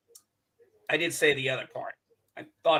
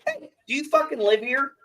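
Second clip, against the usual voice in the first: higher, angry challenge to the neighbor; pissed-off yell; 9 semitones higher than usual. Retold or imitated. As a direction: confront him, fed up. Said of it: "I was pissed".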